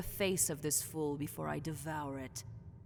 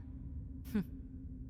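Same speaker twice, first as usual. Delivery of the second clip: short laugh; 4 dB quieter than usual. Acted.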